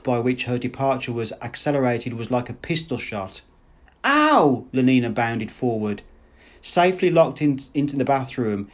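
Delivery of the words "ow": high, loud "ow"; sharp high-pitched yelp; yelp of pain. A narrator's reading. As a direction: cry out at a sudden slap. Woman slapped as she flees.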